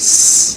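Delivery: স- as a long voiceless hiss; isolated s sound, no vowel after it; initial s demonstrated. Shown as a demonstration. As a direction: hold a pure "sss" alone. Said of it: "ওগুলো এভাবে শুরু হয় ইংলিশে".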